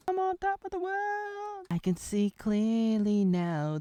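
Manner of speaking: very high, soaring cry, then slow; triumphant girl on the rooftops; exultant. Pretend.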